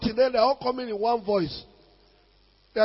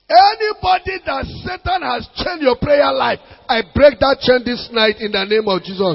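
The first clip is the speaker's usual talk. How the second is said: loud, ringing deliverance prayer; forceful prayer declaration; 3 semitones higher, 9 dB louder than usual. Real, not acted. Preacher breaking chains off the congregation, forceful prayer. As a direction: declare it over them at full force.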